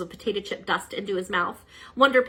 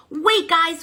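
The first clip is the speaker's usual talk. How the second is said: louder, higher call to stop; urgent cry; 11 semitones higher, 7 dB louder than usual. Acted. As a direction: call the team to halt. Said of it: "cried Super Turbo".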